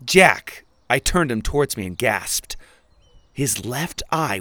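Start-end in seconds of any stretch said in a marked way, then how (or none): 0.0-0.6 loud, urgent call of his name; calling out sharply. Acted. Winnie demanding Jack look at her, loud call. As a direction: snap his name to make him turn.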